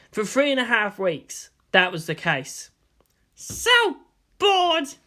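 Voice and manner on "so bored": high, petulant bored whine; childish bored complaint. A narrator's reading. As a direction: sulk it out, high. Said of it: "she kicked out".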